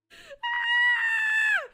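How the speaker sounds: loud, very high sustained shriek; high scream. Real, not acted. Streamer losing nerve before sour candy, shrieking. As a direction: let out a long shriek.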